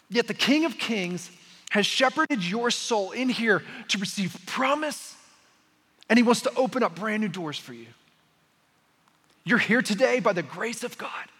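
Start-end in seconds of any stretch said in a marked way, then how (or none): none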